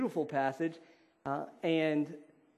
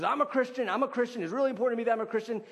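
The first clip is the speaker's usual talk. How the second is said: higher-pitched, mock-insistent protest; insistent claim; 7 semitones higher, faster than usual. Man insists on his label in a debate, mock-insistent. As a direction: protest it higher, picking up speed.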